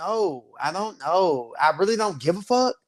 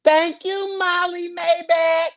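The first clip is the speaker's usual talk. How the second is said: excited thanks; 9 semitones higher, slower than usual. Real, not acted. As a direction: shout your thanks up high. Thanking a donor.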